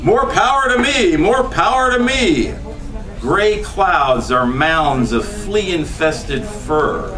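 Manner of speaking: usual speaking voice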